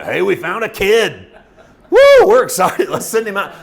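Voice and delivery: hammed-up, high whoop of fake delight; jokingly cheer, loud and high on the woo; mock excitement. Soldiers jokingly reporting to Saul, mock-excited. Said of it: "repeat them to Saul as a joke".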